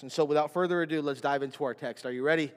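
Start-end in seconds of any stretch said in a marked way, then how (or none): none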